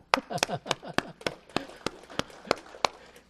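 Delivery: a light real chuckle; laugh. Real, not acted. Pastor laughs after applause. (轻声笑)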